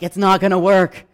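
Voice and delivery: loud worried cry; anxious outburst. Retold or imitated. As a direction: blurt out the night-time doubt. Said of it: "I'm like".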